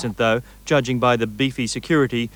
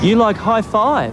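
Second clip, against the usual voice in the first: surprised; 7 semitones higher, 5 dB louder than usual. Real, not acted. Reporter reacts to child's answer.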